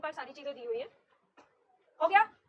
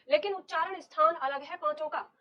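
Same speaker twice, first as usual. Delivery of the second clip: normal talking voice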